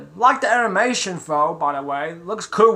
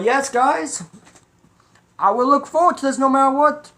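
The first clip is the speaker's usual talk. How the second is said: excited promise; 8 semitones higher, 4 dB louder than usual. Real, not acted. Eager promise to viewers.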